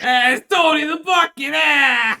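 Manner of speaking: loud, high hammed-up Italian yell; mock Italian shout. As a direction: bellow it like a proud Italian townsman. Mock Italian townsman cheering bucket.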